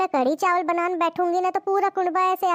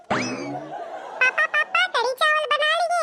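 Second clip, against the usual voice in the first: put-on much higher child's voice, giggling; child calling; 8 semitones higher, much slower than usual. Child calls papa that the food is cooked, high giggling child voice.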